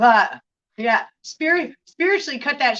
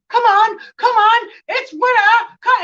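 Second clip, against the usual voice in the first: much higher, louder pleading squeal; hammed-up Titanic guy; 13 semitones higher, 9 dB louder than usual. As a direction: whine like the clingy guy in the water. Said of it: "the guy's like".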